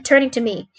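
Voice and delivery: ordinary delivery, nothing marked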